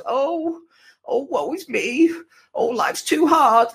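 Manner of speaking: high, slow self-pitying whine; whiny voice. Pretend. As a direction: moan like life's unbearable. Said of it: "Do you like my voice, by the way? My whiny voice?".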